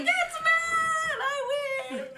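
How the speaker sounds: high laughing shout of triumph; gleeful shout. Retold or imitated. Player spotting her friend join, laughing victory shout. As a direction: crow it like you just won. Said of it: "And I was like".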